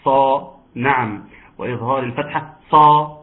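صا with a full, heavy ص, said loud; loud emphatic صا demo; heavy sad. Shown as a demonstration. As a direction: punch صا thick and emphatic. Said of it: "تفخيم الصاد".

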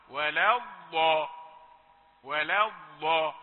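slow ولا الضا with light pressure, ضاد unstopped; soft ḍād. Shown as a demonstration. Teacher showing the light, soft ḍād. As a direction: ease the pressure and let the ضاد flow. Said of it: "خفف الضغط على مخرج الضاد".

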